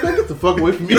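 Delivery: ordinary talk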